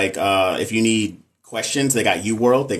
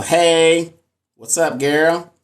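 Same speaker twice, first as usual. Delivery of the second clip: higher, louder cheerful hello; excited greeting; 6 semitones higher, 4 dB louder, much slower than usual. Real, not acted. Host greeting a viewer excitedly by name. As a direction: greet her with a burst of energy.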